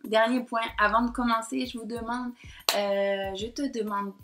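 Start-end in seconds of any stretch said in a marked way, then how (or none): none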